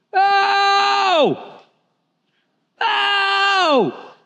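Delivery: high desperate cry out; blind men yelling. Retold or imitated. Blind men crying out to Jesus, desperate yell. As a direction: shout it like a blind beggar begging for attention. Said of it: "They're yelling".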